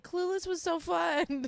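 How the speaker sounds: high, slow dreamy gushing; mocking young fan. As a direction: mimic a starry-eyed kid who never lived it. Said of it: "They're looking like".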